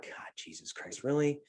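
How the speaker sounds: whispered exasperation under the breath; fed-up customers in line whispering in disbelief; exasperated whisper. Retold or imitated. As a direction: mutter it unvoiced to the people beside you.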